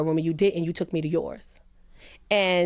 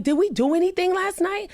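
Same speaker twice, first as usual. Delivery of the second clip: high, alarmed asking; waking up scared, asking what happened; panicked question; 11 semitones higher than usual. Retold or imitated. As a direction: ask in rising panic. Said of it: "And I was like".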